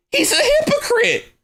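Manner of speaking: hypocrite in a high, mocking squeal. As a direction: pitch way up on hypocrite to mock him. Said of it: "so he's a hypocrite".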